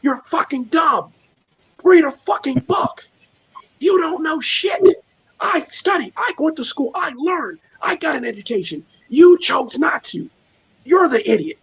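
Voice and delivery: high-pitched, fed-up tirade at the idiots; exasperated doctor rant. Retold or imitated. Doctor cussing out a conspiracy guy on Clubhouse, high exasperated rant. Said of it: "She's like".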